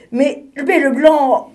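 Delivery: stammering, rising irritation; getting annoyed. Acted. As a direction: splutter as the penguin loses patience. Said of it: "qui commençait à s'énerver".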